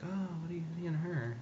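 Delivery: lightly voiced prissy onlooker; mocking voice. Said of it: "they're like".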